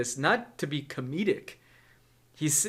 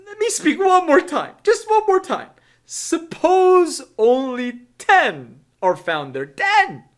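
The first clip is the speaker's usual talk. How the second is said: high, loud, desperate begging; Abraham pleading; 10 semitones higher, 6 dB louder than usual. Acted. Abraham asking God one last time, high desperate pleading. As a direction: squeak out one last bold plea.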